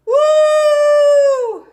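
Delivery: high, loud victory whoop; loud woo. Real, not acted. Streamer cheering the race win, loud high whoop. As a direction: whoop with joy.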